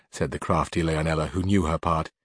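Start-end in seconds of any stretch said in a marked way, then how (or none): none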